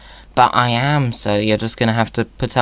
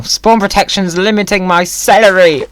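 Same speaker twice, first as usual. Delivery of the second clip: mock outrage at celery; 8 semitones higher, 6 dB louder, slower than usual. Scolding the celery.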